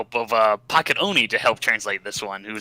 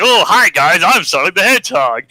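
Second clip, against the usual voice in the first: Eggman posing as Sonic; 7 semitones higher, 7 dB louder than usual. Retold or imitated. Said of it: "who's like".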